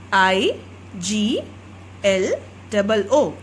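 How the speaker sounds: name each letter of I G L O O, low and separate; spelling out igloo. Shown as a demonstration. Teacher spells igloo letter by letter for pupils.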